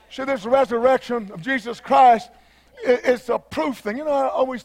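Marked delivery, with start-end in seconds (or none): none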